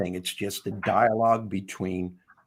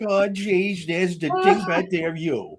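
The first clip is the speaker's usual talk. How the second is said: higher, exasperated oh geez; exasperated reply; 9 semitones higher, slower than usual. Retold or imitated. Author mimicking her own exasperated answer to Bob. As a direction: roll your eyes at the voice. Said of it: "And I'm like".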